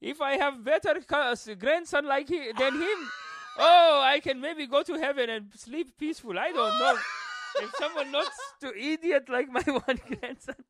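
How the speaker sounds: high, slow accented mock lament; guilt-trip with theatrical woe, half laughing; passive-aggressive grandfather. Grandpa bemoaning his idiot grandson, passive-aggressive wailing. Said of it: "your grandfather passive aggressive".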